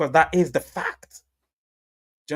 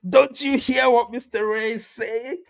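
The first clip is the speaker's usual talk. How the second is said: much higher, slow mock voice; quoting a commenter; 9 semitones higher, slower than usual. Reading a viewer's comment in a high put-on voice. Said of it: "Success here says".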